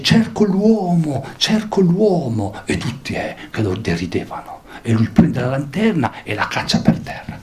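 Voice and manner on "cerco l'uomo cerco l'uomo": slow, high calling voice; Diogenes calling. Acted. The cynic crying to the mocking crowd, slow and high. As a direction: call out slowly with a lantern in the crowd. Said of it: "con una lanterna e dice".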